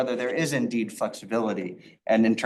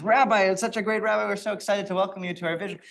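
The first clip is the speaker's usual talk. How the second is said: higher, gushing welcome to an honored guest; eager welcome; 7 semitones higher, faster than usual. Retold or imitated. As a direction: greet the rabbi like an excited fan. Villager eagerly greets great rabbi.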